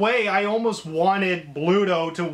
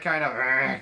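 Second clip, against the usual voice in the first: Popeye; 5 semitones lower than usual. Retold or imitated. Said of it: "kind of voice".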